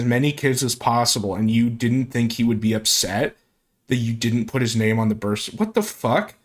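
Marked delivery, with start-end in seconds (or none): 5.5-6.3 high disbelieving outburst; incredulous. Real, not acted. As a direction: blurt it in disbelief. Disbelief at story.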